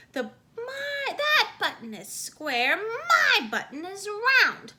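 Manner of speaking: high, loud tearful wail; wailing Toad. A narrator's reading. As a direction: keep wailing through the complaint. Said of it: "wailed Toad".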